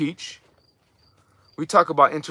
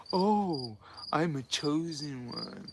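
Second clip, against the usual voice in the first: mock chosen one; 2 semitones lower, 12 dB quieter, slower than usual. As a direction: parody someone lost to the dark side, quietly proud. Mocking the fallen chosen one again.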